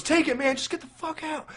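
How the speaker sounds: high, panicked mock yell, acting startled; freaked-out reaction. Nico reacting to Pinhead popping up, high panicked mock yell. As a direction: squeal it out like a kid who just saw a monster. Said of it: "And he goes".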